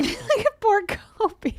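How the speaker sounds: high, drawn-out words through a laugh; laughing pity. Real, not acted. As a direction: laugh while feeling sorry for the dog. Laughing at the pitied dog.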